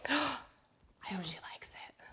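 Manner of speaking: gasp, then a hushed whisper. Real, not acted. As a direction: whisper nervously aside, slowly. Nervous aside while she tastes.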